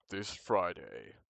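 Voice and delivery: slow, loud trailer line; movie trailer voice. Retold or imitated. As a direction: imitate a movie trailer announcer, drawn out. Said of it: "like, a movie trailer".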